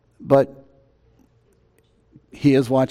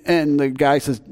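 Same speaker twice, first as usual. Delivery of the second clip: usual speaking voice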